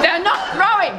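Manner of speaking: high-pitched storybook wail; acted childlike protest, pitched high; upset complaint. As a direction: wail it in frustration. Monkey complains to Platypus.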